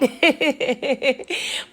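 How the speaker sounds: a quiet laugh at herself; soft laugh after bragging about her birthday. Real, not acted. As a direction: chuckle softly.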